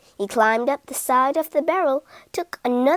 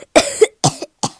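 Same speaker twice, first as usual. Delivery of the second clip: loud acted choking fit; hacking as if burnt by a drink; choking cough; 6 dB louder than usual. A narrator's reading. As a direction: cough and splutter hard. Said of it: "And most choked".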